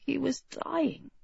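hushed, numb narration. A narrator's reading. Dying king thinking to himself, numb and hushed. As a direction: let it sink in, very softly. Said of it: "he thought numbly".

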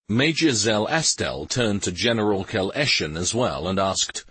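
ordinary delivery, nothing marked

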